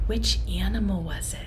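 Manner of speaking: hushed secret question; whisper. Shown as a demonstration. Teacher whispering the riddle to the children. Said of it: "whispered clue".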